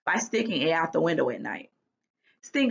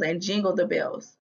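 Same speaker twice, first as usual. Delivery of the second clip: ordinary delivery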